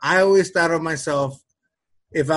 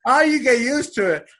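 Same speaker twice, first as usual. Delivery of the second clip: higher, lightly voiced casual workman; breezy repairman; 9 semitones higher, 3 dB louder than usual. Repairman shrugging off the gas, higher breezy tone. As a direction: shrug it off like nothing. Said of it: "You just did it like that".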